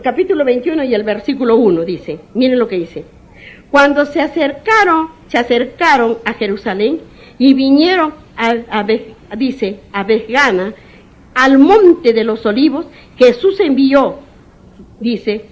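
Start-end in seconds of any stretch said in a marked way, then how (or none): 3.7-14.2 scripture reading. Acted. Preacher reading scripture aloud.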